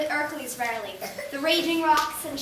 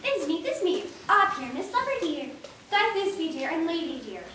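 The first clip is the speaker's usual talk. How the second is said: ordinary talk, nothing marked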